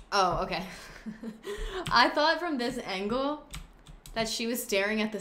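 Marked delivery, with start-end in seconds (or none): 0.7-1.9 a short, high, breathy giggle; high laugh. Real, not acted. Gamer laughs at misreading her Sim's gaze, high giggle. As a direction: laugh at her own mistake.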